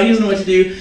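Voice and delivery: ordinary talk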